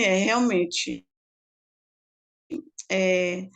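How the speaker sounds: slow, low, changed voice for the nurse. Retold or imitated. Nurse confirming to the doctor, low and slow. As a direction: drag the words out low like a nurse agreeing. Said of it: "Aí a enfermeira foi e falou assim".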